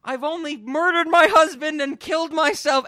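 high, loud shrieking mother; hysterical mom. Retold or imitated. Host imitating the mother's hysterical greeting, high shriek. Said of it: "when she's like".

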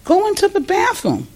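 mock urging from the other women. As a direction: coax her gently through the door. Women urging the crying woman.

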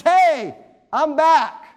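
big, punchy comeback shout; calling out. Acted. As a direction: call it out like a triumphant return. Acts out risen Lazarus announcing himself.

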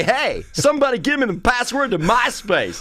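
high, hammed-up crowd-work voice; mocking hack comic. Hack college comic hollering at the audience, mocking stage voice. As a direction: parody a dated comic calling out to the audience. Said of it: "You do that impression 'cause you do it well".

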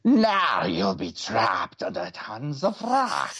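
gloating reptile monster; lizard hiss. A narrator's reading. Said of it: "hissed the lizard-like Whiplash".

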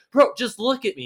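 high-pitched retold insistence; mock exasperated plea. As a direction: imitate a friend pleading in exasperation.

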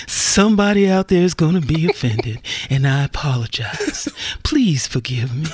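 low, slowing mock apology; Sounder voice. Apologizing to offended listeners, still in the low Sounder voice. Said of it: "in the Sounder voice".

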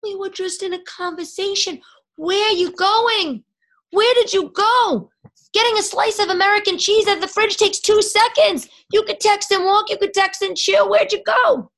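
high, loud mock outrage; shrill hammed-up where-did-you-go yell; exasperated shout. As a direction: yell at the guy who vanished mid-chat. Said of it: "it's like".

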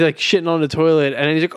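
ordinary talk

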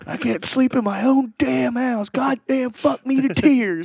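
imitating the friend swearing while teasing him; mock rant. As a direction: drag out the rant like a grumpy sleeper.

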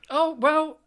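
ordinary talk, nothing marked

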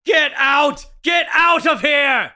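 angry shouting. Acted. Man yells at the policeman to get out of his house, angry shouting. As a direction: throw the neighbor out, shouting.

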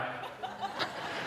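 airy burst of real laughter. Real, not acted. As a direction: laugh out at the absurdity. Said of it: "Right? I mean, come on".

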